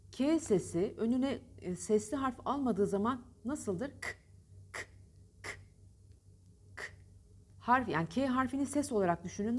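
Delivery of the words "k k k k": K, a bare k sound with no vowel; isolated k sound. Shown as a demonstration. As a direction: click out a voiceless k on its own. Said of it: "K sesini verin".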